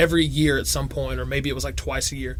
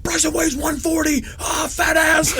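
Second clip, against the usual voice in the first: put-on mean kid; 12 semitones higher, slower than usual. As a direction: yell it like a schoolyard bully. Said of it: "It was like".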